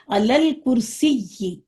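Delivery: علل کرسی drawn out very slowly; slow model word. Shown as a demonstration. Teacher modelling the phrase for her students, very slowly. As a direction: say it slowly for repetition. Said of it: "مطلب کیا ہو گیا؟".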